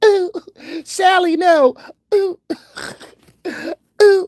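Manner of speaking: very high, loud falsetto pleading; hammed-up yelps and whimpering protest; mock squealing victim. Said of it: "You be like".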